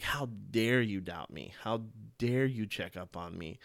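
ordinary talk, nothing marked

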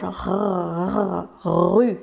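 r r rue with a small rolling R in the throat; R français roulé dans la gorge; French throat R. Shown as a demonstration. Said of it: "un petit roulement dans la gorge".

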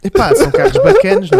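high, loud gush; excited. Real, not acted. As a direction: rave about the cars.

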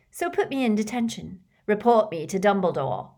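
mock the threat, daring him; jeering. A narrator's reading. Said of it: "jeered Malfoy".

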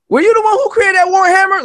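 high, loud, thrilled question; light up with recognition; excited recognition. Retold or imitated. Meeting a long-lost kindred soul, excited high question.

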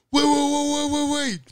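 high, rapid string of waits; imitating a high-pitched plea to stop; acted urgent halt. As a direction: mimic someone frantically calling a halt. Acted cowboys calling a halt.

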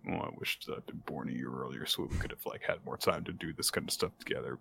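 very soft, low acted murmur; quiet wistful aside. Podcaster voicing Taiki wishing he'd been born earlier, quietly wistful. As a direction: drop right down, as if wishing to himself.

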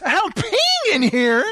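high, drawn-out mock outrage; indignant protest. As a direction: imitate her protesting the intrusion. Woman interrupted on the toilet.

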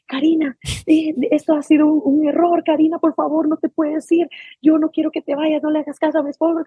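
retold pleading. Employer's wife begs nanny not to leave, high-pitched retold pleading.

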